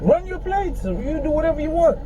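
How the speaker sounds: usual speaking voice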